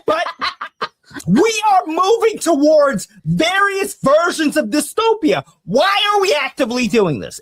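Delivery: high, strained outrage; outraged rant. Real, not acted.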